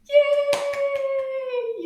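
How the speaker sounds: a very high, loud cheer; excited cheer. Real, not acted. Teacher cheers the kids for finishing, excited. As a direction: celebrate like they just won.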